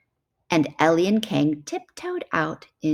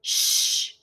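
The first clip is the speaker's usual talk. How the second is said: shushing. Acted. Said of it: "said the chimp".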